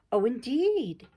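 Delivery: haughty exclamation of polite dismay; dismayed disdain. A narrator's reading. Miss Kate learns Meg is a governess, dismayed disdain. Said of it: "her tone implied it".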